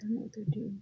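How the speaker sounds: whispered to herself; breathy whisper, barely audible. Real, not acted. Muttering to herself alone.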